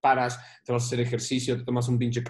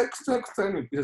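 ordinary talk

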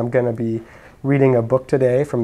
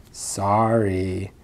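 ordinary delivery

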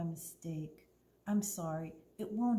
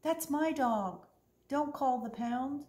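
higher, pleading call; hollering; 7 semitones higher, slower than usual. Acted. Opal pleads with the manager for the dog, urgent holler. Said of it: "I hollered".

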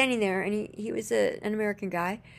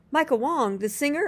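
higher, louder astonished question; astonished double-check, pitched up; retold surprise; 7 semitones higher, 4 dB louder than usual. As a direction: blurt it out in amazed recognition. Retold surprised recognition of friend's name.

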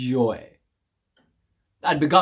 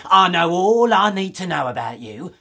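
louder, harder voice cutting him off; hostile retort; 4 semitones higher, 6 dB louder than usual. Acted. Shop woman with shotgun to the stranger: loud hostile cut-off. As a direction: shut him down with contempt. Shopkeeper aims shotgun at narrator.

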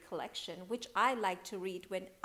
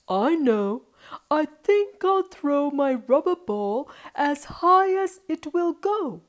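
put-on louder, lifted, excited boy's voice; eager boy; 7 semitones higher, 16 dB louder than usual. Boy decides to throw his ball high, eager excitement. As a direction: voice a child struck by an idea.

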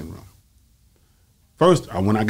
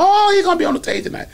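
much higher, chatty bragging voice; mock the wife gossiping at the hair shop; 3 dB louder than usual. Wife bragging at the hair shop, gossipy. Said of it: "running her mouth".

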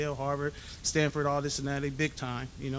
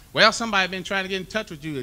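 higher, louder motherly announcement; imitating his mother; 6 semitones higher, 9 dB louder than usual. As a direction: deliver the news like mom on the phone. Mother voiced on phone.

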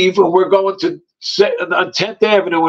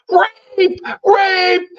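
high, drawn-out mock scream; sustained high-pitched call, acted; retold cry for help; 11 semitones higher than usual. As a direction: imitate calling out in alarm. Woman screaming for help in car.

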